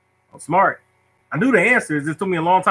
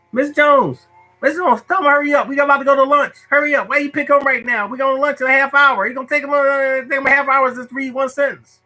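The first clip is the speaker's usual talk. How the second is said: high-pitched whining schoolkid; complaining kids; 11 semitones higher than usual. Retold or imitated. Kid begging Miss Jones to hurry him up, high whine. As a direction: whine high and urgent to the teacher, barely pausing. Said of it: "the kids would be like".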